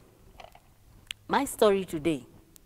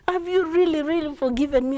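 higher-pitched acted plea; earnest, lightly acted pleading; pleading son; 6 semitones higher, 3 dB louder, faster than usual. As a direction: beg for reassurance. Son pleads with his father.

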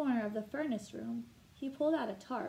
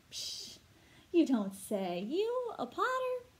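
amazed; 8 semitones higher, slower than usual. Acted. Old John learning Mary was a potter, amazed. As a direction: act astonished, pitch leaping up at the end.

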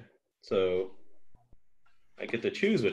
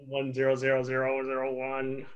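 chanting binary like a robot; 2 semitones higher than usual. Pretend. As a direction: recite the digits like a machine. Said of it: "a Flight of the Conchords".

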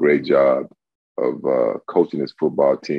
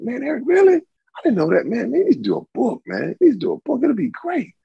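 much higher, excited mock voice of friends; imitating impressed friends. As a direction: play the buddies egging him on. Friends urging him to write book.

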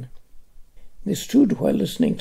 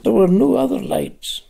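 usual speaking voice

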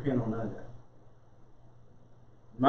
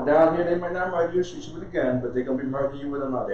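usual speaking voice

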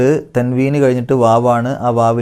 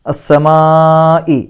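very slow, louder, long-stretched vowel; Quran recitation; 4 semitones higher, 5 dB louder, much slower than usual. Shown as a demonstration. Teacher shows the obligatory long vowel, chanting. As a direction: stretch the madd fully. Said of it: "നിർബന്ധമായിട്ട് നീട്ടേണ്ട മദ്ദാണ്".

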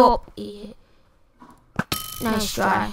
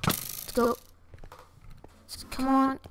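normal talking voice

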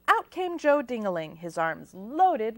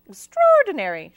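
much louder and higher, flustered; fluttered; 5 semitones higher, 13 dB louder than usual. A narrator's reading. Missus Pink surprised at the quick delivery, flustered gush. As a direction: gush at the fast pizza. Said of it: "she fluttered".

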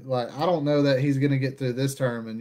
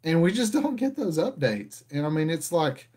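ordinary delivery

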